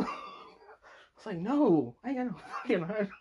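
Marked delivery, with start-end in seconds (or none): 1.4-3.1 high, fast mock protest; hammed-up high-pitched indignation; laughing refusal. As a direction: squeal the denial as you crack up. Retold refusal while laughing.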